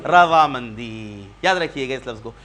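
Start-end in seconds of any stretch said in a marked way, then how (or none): 0.1-1.2 slow model answer. Shown as a demonstration. Teacher models answer slowly.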